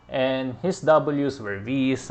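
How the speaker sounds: usual speaking voice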